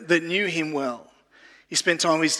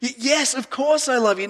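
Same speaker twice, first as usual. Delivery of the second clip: higher, earnest pleading voice; passionate Peter; 8 semitones higher than usual. Retold or imitated. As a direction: plead as a devoted friend.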